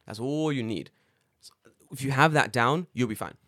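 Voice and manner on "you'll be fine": normal talking voice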